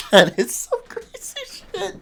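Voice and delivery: high-pitched laughing disbelief. Real, not acted.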